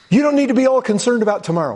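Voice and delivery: preacher urging the congregation; fervent preaching. Real, not acted. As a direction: rush it with passion. Preaching at full force.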